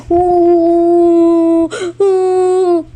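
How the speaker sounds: 响亮的孩子呜咽哭声; 高声拖长的哭泣呜呜; 呜呜哭声. Acted. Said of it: "那是小鹿的哭声". (响亮的呜呜哭声)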